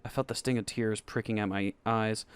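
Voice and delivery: ordinary delivery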